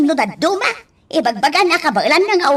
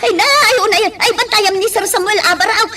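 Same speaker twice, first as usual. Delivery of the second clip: urgent orders; 5 semitones higher than usual. Acted. Nurse shouting urgent orders to rush him to hospital.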